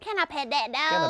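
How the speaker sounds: slow mock southern drawl; lightly drawled southern accent. Streamer echoing the southern role-play line. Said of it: "I think we were going".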